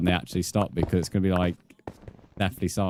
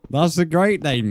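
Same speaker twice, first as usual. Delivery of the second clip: mock praise; 7 semitones higher, 5 dB louder than usual. Mocking Caleb's new name.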